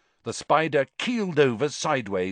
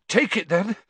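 panting; 7 semitones higher, 3 dB louder than usual. A narrator's reading. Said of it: "Harry panted to Cedric".

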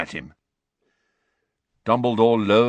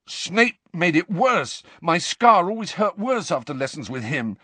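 put-on high, heated protest from an upset boy; angry outburst; 10 semitones higher than usual. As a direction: accuse him hotly in a raised pitch. Harry rants at Dumbledore.

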